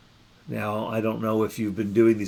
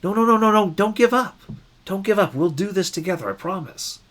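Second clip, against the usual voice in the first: reassuring rebuke; 9 semitones higher than usual. Retold or imitated. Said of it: "We go like".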